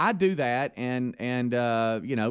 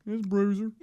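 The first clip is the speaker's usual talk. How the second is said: higher, different voice for Bruiser; mock Bruiser voice; 8 semitones higher than usual. Speaker imitates his coworker introducing himself on the phone, in a higher put-on voice. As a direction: lightly put on as the caller.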